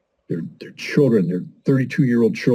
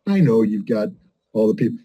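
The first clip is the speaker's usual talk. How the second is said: ordinary talk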